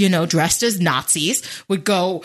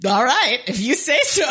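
skeptical; 3 semitones higher than usual. Retold or imitated. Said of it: "it was just like".

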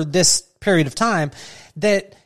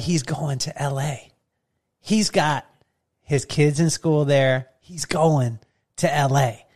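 quiet insistence; 4 semitones lower, 5 dB quieter, slower than usual. Retold or imitated. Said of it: "And you were like".

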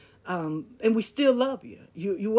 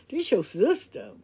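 ordinary talk, nothing marked

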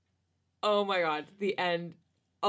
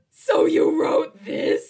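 loud, slow, half-crying laugh; loud teasing; 13 dB louder, much slower than usual. Real, not acted. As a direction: accuse your friend through tears of laughter.

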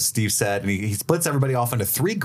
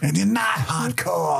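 catchphrase; 11 semitones higher, slower than usual. Retold or imitated. Joining the catchphrase.

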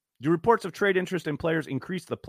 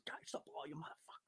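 imitating a whisper under the breath; whispered frustrated curse; 14 semitones higher, 26 dB quieter than usual. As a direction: breathe the words out with no voice, as if mouthed on the field. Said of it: "and he goes".

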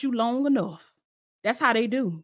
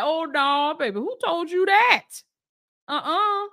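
doting king; 4 semitones higher than usual. Retold or imitated. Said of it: "the daddy was like".

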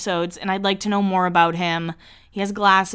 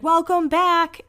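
high, drawn-out welcome; mock greeting; 9 semitones higher, slower than usual. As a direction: sing out a slow, high greeting. Acted friend greeting the arrivals.

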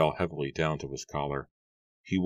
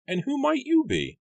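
much higher, put-on voice for the farmer; gruff farmer Matthew; 3 dB louder than usual. A narrator's reading. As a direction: play the grumpy farmer, pitched way up. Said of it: "clearing his throat".

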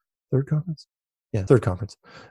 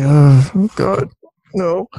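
a hammed-up, higher "oh God, no"; mock exasperation; 6 semitones higher, 5 dB louder, much slower than usual. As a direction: groan it like you're sick of the question. His weary reply to fans.

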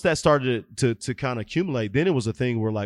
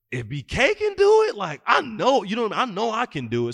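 inspired listener; 11 semitones higher, 3 dB louder than usual. Retold or imitated. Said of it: "for somebody to hear and say".

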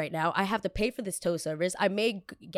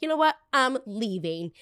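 much higher and louder exit line; higher, louder mock huff as she walks out; huffy declaration; 5 semitones higher, 4 dB louder than usual. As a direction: announce it like storming out. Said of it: "So I'm like".

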